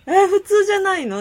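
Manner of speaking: surprised retort. Retold or imitated. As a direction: act out a baffled comeback, half laughing. Retells own surprised reply to friend. (笑い混じりに驚いて聞き返す)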